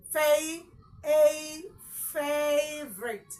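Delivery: "favorite" broken out slowly, fay, ay, fay-vrit; correct pronunciation. Shown as a demonstration. Teacher modelling for learners, "favorite" slowly as FAY-vrit. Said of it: "The correct way".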